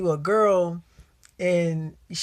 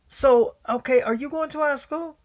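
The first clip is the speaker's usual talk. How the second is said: high-pitched, eager questioning; imitated excited girls; 9 semitones higher, 4 dB louder than usual. Girls quizzing David Sims in the hallway, imitated excitement. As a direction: pitch it way up like curious schoolgirls.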